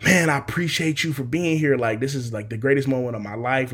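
imitated lifted, energized gratitude; excited thanks. Dad to son after the good news, excited thanks. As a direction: thank him with a burst of happy energy. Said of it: "Like, he's excited now".

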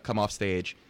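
ordinary delivery

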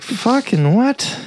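high, drawn-out mock disbelief; slow rising imitation of a baffled reaction; incredulous. Cab driver hears Bill's terms, mock incredulous.

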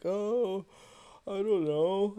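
high, coy mock innocence; light high-pitched imitation of her playing dumb; mock evasive. Girlfriend dodging his question about the flowers, mock evasive.

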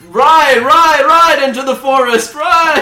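excited calling. Acted. Narrator excitedly calls.

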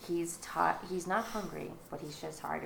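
ordinary talk

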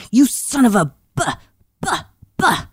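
high, stuck on the first sound of a word; retold sputtering anger. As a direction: imitate a furious mother stalling mid-insult. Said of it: "she was getting angrier and angrier".

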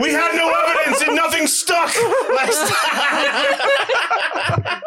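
high-pitched joke through giggles; laughing. Real, not acted. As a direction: laugh while getting the words out. Joking through laughter after scream.

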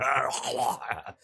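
slack-jawed monster groan; open-mouthed wordless creature noise; imitated monster roar. Host imitating monster whose jaw won't move, groaning roar. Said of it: "he's just kinda like".

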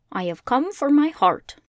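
pleading for a heart; Tin Woodman. Acted.

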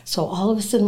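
ordinary talk, nothing marked

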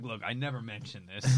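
dropped low and soft, secretive; hushed confession. Retold or imitated. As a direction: murmur it like a guilty secret.

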